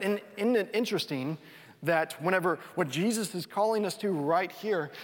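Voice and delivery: ordinary talk, nothing marked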